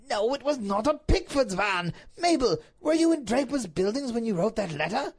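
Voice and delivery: heated husband's outburst; exasperated Duke. Acted. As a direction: snap back, then interrogate forcefully. Duke exasperated, interrogating wife.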